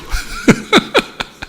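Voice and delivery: soft chuckling; short laugh at one's own joke; real laugh. Real, not acted. (낮게 터진 웃음)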